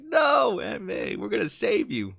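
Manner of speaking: hammed-up pleading to save her; desperate plea. Player begging to save dying Aunt May, loud desperate plea. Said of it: "I was like".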